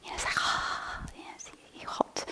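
breathy, hushed evocation of heat; fiery feeling shown. Shown as a demonstration. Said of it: "the mind feels fiery".

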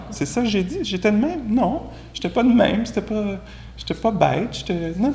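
high, incredulous mock protest; mock indignant denial. To the audience, imitating his defensive self, high incredulous denial.

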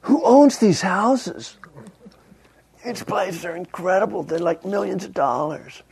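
high, drawn-out mock-astonished voice; daughter's amazed outburst. Daughter gawking at golf-course houses, high mock amazement. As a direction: put on a high, incredulous gush. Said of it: "My daughter was like".

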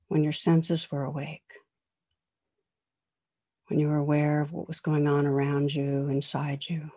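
guided meditation. Acted. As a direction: guide listeners through calm reflection. Leads a reflection meditation.

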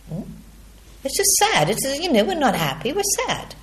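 a playful, lilting take on the inner voice calling everything sad; lightly mocking sing-song. Mock voice of judging mind.

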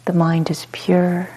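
calm, unhurried meditation guide with long pauses; short soothing phrases, falling gently into silence; guided meditation. Real, not acted. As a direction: guide them into spacious ease, letting each phrase settle.

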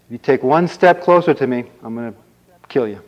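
storyteller's bold hero threat; acted threat. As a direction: warn the monster off forcefully. Prince threatens the ogre.

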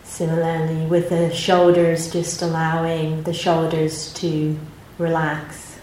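calm, unhurried meditation guidance with long pauses; guided meditation. Acted. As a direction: gently guide the group into their posture and body.

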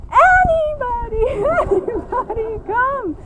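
put-on high, loud, slow child's wail; desperate child call. Child in bed finally calling anybody at all, high and drawn out. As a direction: stretch it out like a kid giving up on mom. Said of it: "finally it ends up at".

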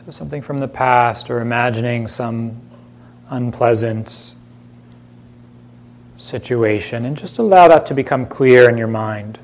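unhurried meditation voice with long silences between phrases; calm, spacious delivery, leaving room to breathe; guided meditation. Acted. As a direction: gently guide listeners inward, phrase by phrase.

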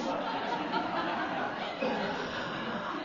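breathy, soft fit of giggles; real laughter. Real, not acted. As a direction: laugh helplessly under your breath. Laughs at own remark.

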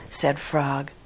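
plain reading voice

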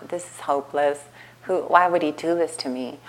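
low, quiet retold despair; angry and sad. Student distraught at her teacher's behaviour, low hopeless murmur. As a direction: replay feeling defeated, voice dropped and soft. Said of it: "angry and sad and like".

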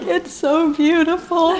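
high, slow, laughing through the words; breathless wonder through laughter; half-laughing, half-weeping delight; laughing awe. Retold or imitated. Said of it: "And I was like".

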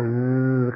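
drawn-out "zzz" of a motor; imitated motor buzz. Shown as a demonstration. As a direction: buzz like a shade coming down. Imitates a motorized shade descending.

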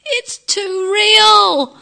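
loud, high, drawn-out cartoon wail; slow, hammed-up howl of protest; wailing child. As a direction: play an overwhelmed little boy crying out. Cartoon boy wailing outdoors.

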